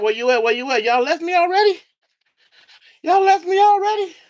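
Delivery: high-pitched, incredulous question to the viewers; mock dismay. Real, not acted. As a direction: pitch jumps way up, playfully abandoned. Streamer finds chat empty.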